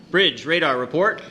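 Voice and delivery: loud call, pitch rising; captain barking for a radar report; shouted order. Acted. Captain calls radar during battle.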